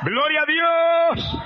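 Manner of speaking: a long, drawn-out "¡Gloria a Dios!" shouted high; shouted praise. Real, not acted. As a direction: cry it out to the congregation. Preacher exclaims praise.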